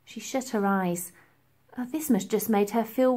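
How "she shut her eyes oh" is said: usual speaking voice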